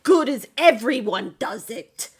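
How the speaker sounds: loud, scolding noble; stern noble voice. Acted. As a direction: lecture him on honor. GM voices an NPC.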